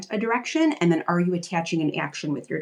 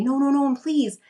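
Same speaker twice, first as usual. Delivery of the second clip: higher, pleading kid voice, lightly mocked; begging kid; 7 semitones higher than usual. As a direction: beg like a child who won't give up the show. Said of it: "what she described as begging".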